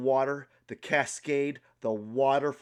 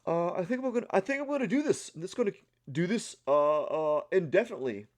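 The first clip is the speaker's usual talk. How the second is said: lightly voiced casual tough guy, higher; mock Joe Rogan; 6 semitones higher, faster than usual. Imitating a podcaster calmly in his ice bath, lightly mocking. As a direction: breezily brag about sitting in ice. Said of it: "he's just like".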